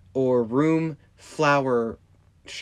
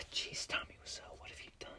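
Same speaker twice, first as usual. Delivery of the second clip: whisper; 21 dB quieter, faster than usual. Real, not acted. Exasperated aside.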